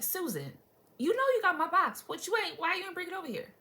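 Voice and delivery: confronting a neighbor. Pretend. Imagining confronting neighbor over the box, indignant. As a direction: call her out by name, then pitch it up.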